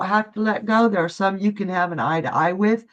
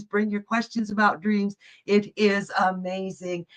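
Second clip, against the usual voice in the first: ordinary talk, nothing marked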